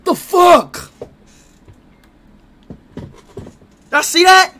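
high, strained voice after choking; recovering from choking. Real, not acted. Streamer tells chat he almost choked, strained and high. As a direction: squeeze the words out high after a near choke. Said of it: "almost choked".

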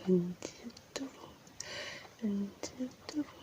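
whispered channel greeting; soft ASMR whisper intro. Real, not acted. (속삭이는 ASMR)

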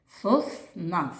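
"Сосна" with a clear gap between Сос and на; "Сосна" in two separate syllables; split into syllables. Shown as a demonstration. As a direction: pause midway through "Сосна", after Сос. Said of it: "поділимо на склади слово".

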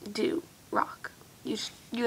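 hushed praise; whispering. Real, not acted. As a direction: whisper it like a secret. Sincere message to her viewers.

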